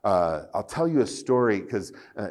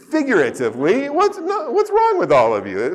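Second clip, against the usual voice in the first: laughing; 10 dB louder than usual. Real, not acted. Audience laughs at his joke.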